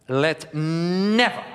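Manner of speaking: "never" drawn out slowly, long N, rejecting; irascible never. Shown as a demonstration. Coach demonstrating to students, a slow rejecting "never". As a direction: stretch "never" like pushing something away. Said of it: "So it is almost irascible".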